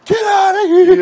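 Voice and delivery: high, loud hammed-up yell; mock yell. A joking coach orders a player out, mock yell. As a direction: throw him out in jest.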